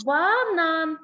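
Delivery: Банан slowly, with long А vowels; sounded-out Банан for a pupil; stretched word. Shown as a demonstration. Teacher gives word to hunt А.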